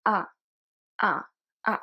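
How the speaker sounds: "A" open like the a in father. Shown as a demonstration. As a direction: open the mouth wide on "A".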